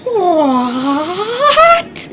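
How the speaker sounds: longer, swooping "what" that climbs high; hammed-up incredulous rising what; drawn-out disbelief. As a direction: stretch it wide and let the pitch soar. Repeats the exaggerated disbelief.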